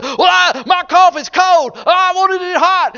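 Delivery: loud, high whining complaint; mock crybaby at the restaurant whining about cold coffee; hammed-up complaining customer; whiny customer. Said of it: "This guy says".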